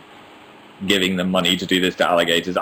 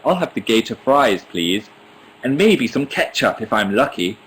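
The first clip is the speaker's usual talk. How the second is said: put-on polite, lightly done British accent on "gator fries, please". Imagining herself ordering gator fries beside the American, British accent. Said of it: "I'd sound really British next to him".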